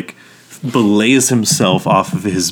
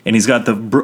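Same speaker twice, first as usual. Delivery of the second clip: normal talking voice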